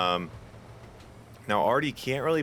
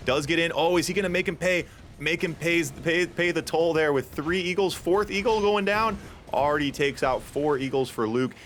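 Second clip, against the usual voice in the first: real excitement, pitched higher; excited commentary; 7 semitones higher than usual. Real, not acted. Caster calls the Eagles going down for viewers, excited. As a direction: call the play with rising excitement. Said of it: "a little excitement in my voice".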